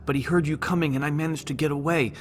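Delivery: high, loud frantic voice; panicked pleading. A narrator's reading. Scared scientist begging the group for help, frantic. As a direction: plead fast in terror.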